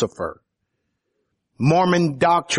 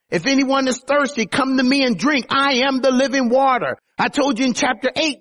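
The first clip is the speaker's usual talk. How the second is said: raised high, ringing declaration; insistent preaching; 7 semitones higher than usual. Acted. Preacher voicing Jesus declaring who he is, high and ringing. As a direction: proclaim it as Jesus, lifted.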